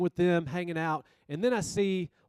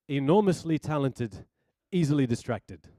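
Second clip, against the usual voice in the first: South African professor impression, a bit emphatic; imitated South African-accented professor; 4 dB louder than usual. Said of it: "South African accent".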